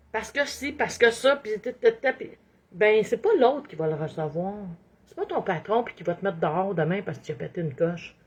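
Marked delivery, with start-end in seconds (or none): none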